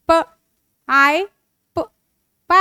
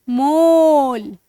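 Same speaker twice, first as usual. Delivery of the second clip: slow blending; much slower than usual. Shown as a demonstration. Teacher blends sounds into word.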